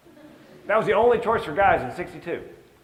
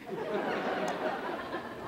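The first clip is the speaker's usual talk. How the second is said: breathy laugh; 5 semitones higher, 10 dB quieter than usual. Real, not acted. Comedian laughing at the announcement.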